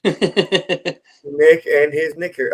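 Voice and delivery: very slow, careful delivery; slow talking. Real, not acted. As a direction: sound the words out slowly. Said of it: "I fucking slow talked that one".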